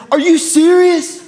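high, slow, whiny disbelief; Rylan's classic whine. Retold or imitated. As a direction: stretch it out like a put-upon teenager. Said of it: "he does".

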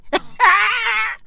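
squealing laugh; shrieking laugh. Real, not acted. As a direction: lose it laughing. Laughing at own joke.

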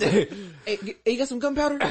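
high, laughing soldier voice; panicked soldier. Pretend. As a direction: beg for gunpowder mid-battle. Acting a soldier asking gunpowder.

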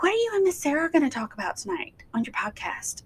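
whispered, fast question; daughter's question. Retold or imitated. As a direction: whisper it quickly like a curious kid. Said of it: "she was like".

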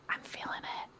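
hushed excited whisper. Real, not acted. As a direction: whisper along with her. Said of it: "Use your whisper voice".

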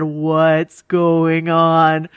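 a hint of slow unease; drawn out slowly, uneasy; slow wary question. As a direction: sister turning slowly, mildly spooked. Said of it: "she slowly turned around".

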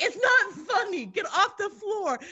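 high, slow, indignant whine; exasperated scolding. Retold or imitated. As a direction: scold someone for laughing at you. Retold scolding of laughing relative.

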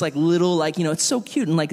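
ordinary delivery

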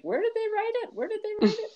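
high, fast puzzled "where did they write it"; mock-frantic searching for the post; exasperated searching. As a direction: mimic hunting around confused. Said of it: "'Cause we had to go".

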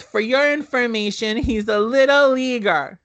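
high-pitched, know-it-all little sister; bratty little sister. Retold or imitated. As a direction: play the smug kid sister cutting him down. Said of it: "this is where Danny goes".

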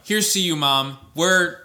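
high, loud ceremonial toast; mock toast. Toasting mom over the wine, high and loud mock toast. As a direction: raise a glass and proclaim it.

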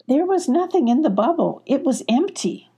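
mildly acted alarm; concerned. A narrator's reading. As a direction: report it with lifted, troubled voice. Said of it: "expressing her concern".

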